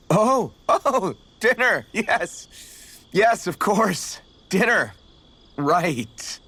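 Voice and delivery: high, slow, embarrassed backpedalling; flustered. Acted. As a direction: cover the slip, pitch jumping up, stretched out. Flustered after misunderstanding dinner.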